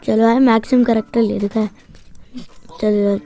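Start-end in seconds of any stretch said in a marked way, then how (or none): none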